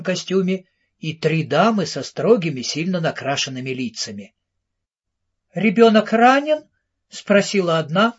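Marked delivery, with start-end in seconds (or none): 5.5-6.6 a higher, louder reproachful question; stern lady scolding rather than worrying. A narrator's reading. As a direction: ask with blame rather than pity. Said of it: "скорее с упрёком".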